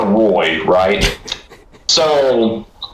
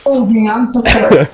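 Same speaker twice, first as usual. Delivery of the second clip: higher, pleading apology to the cat; real remorseful pet talk; 10 semitones higher, 3 dB louder than usual. Real, not acted. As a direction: coo the sorry to your hurt cat. Apologizing to cat after door.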